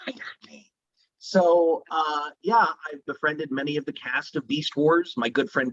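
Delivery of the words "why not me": breathy whispered question. Retold or imitated. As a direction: whisper it in awe. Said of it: "I discovered".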